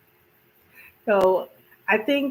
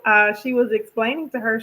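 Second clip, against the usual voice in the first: usual speaking voice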